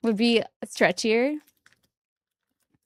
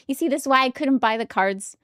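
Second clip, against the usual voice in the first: usual speaking voice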